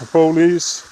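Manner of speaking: ordinary talk, nothing marked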